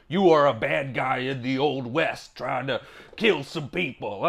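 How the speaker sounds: ordinary talk, nothing marked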